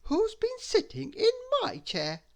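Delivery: high middle bear voice; medium bear voice. A narrator's reading. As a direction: ask it as the mother bear, high. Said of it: "said the medium bear".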